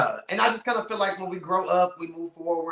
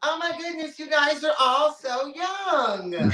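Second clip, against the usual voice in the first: amazed; 8 semitones higher than usual. Real, not acted. Friend marvels at her age, high excited exclaim.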